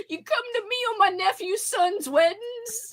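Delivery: high, soft Godfather line; a playful spin on the Godfather plea; lightly voiced mob boss; mock Godfather. Godfather quote retry.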